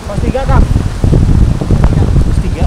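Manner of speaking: loud call over the wind; shouting over wind. Real, not acted. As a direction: raise your voice against the gusts. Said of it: "Semoga saya ngomong kedengeran".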